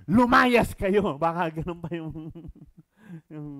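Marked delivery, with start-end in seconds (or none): 0.1-1.1 loud, slow furious shout; angry command. Acted. Jesus ordering the sellers out, slow loud shout. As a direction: bellow them out. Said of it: "sa words ko na lang ito".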